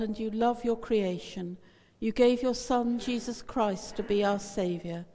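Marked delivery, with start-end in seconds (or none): none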